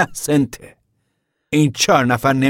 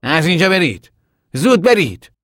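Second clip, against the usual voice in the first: higher, louder shooing; sharp order; 5 semitones higher, 5 dB louder than usual. Acted. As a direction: drive the children off firmly. Tom shoos children away. (دستور بلند و تند)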